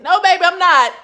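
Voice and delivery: normal talking voice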